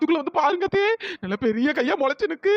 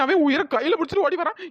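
normal talking voice

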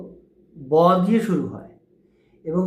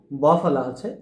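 usual speaking voice

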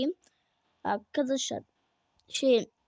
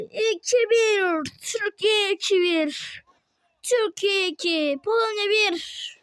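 higher, energetic play-by-play; football commentator; 7 semitones higher, 5 dB louder, slower than usual. Acted. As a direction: narrate every attack like live TV. Calls the match play-by-play.

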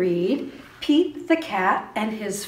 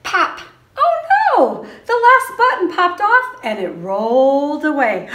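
much higher, louder playful alarm; mock surprise; 8 semitones higher, 12 dB louder than usual. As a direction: gasp it out for the kids, big and high. Last button pops off in story.

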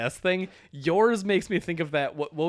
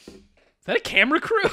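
startled; 13 semitones higher, 5 dB louder, much faster than usual. Pretend. Mock reality show caught line.

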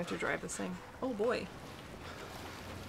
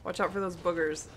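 ordinary talk

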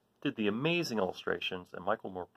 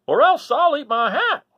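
bellowing farmer; 13 dB louder than usual. Acted. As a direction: bellow it like a stubborn old farmer. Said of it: "Uncle Henry was bellowing".

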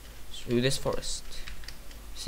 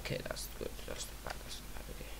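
much softer, whispered worry; tense whisper; 16 dB quieter than usual. Real, not acted. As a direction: hush it under your breath.

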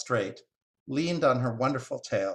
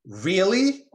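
high, loud, rising question; excited squirrel; 8 semitones higher, 6 dB louder than usual. Acted. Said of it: "The squirrel got excited".

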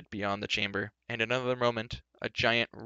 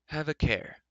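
raised-pitch warning with a thin smile; cool, lean smile; 6 semitones higher, slower than usual. A narrator's reading. War leader cautions the boisterous king, coolly smiling. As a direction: warn him through a lean smile. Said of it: "said Morgant with a lean smile".

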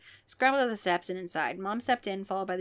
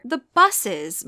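crying out; 2 semitones higher, 8 dB louder than usual. A narrator's reading. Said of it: "Mom cried".

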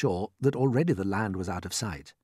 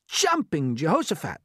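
pitched-up exclamation; astonished cry; 8 semitones higher, 4 dB louder than usual. A narrator's reading. Creatures learn the Peach is food, crying out. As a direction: exclaim it in amazement. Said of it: "they cried".

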